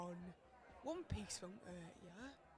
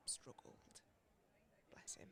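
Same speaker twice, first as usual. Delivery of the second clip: whisper; 8 dB quieter than usual. Acted. Sad memory of late husband.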